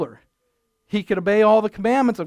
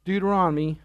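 normal talking voice

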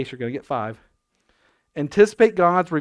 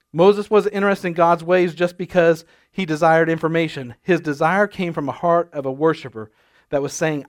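loud preacher delivery; preaching; 6 dB louder than usual. Acted. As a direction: preach it with fire. Preaching at full force.

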